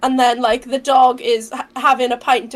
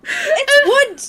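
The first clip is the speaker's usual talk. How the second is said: high, incredulous cackling protest; laughing disbelief; 13 semitones higher, 4 dB louder, much slower than usual. Real, not acted. Streamer reacts to a ban on painting ladders, laughing in disbelief. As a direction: squeal it through real laughter.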